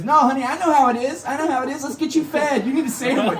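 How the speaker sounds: high-pitched, fussing sympathy; imitated driver taking pity; mothering bus driver. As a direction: fuss over a passenger in a high voice.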